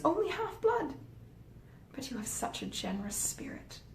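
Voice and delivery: Fanny's mock wonder. A narrator's reading. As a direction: fake amazement, dripping with irony. Fanny mocking the gift.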